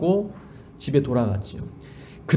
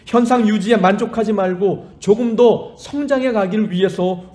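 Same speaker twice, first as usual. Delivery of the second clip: louder, higher exhortation; preaching at full force; 4 semitones higher, 4 dB louder than usual. Acted. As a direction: urge the congregation with fervor.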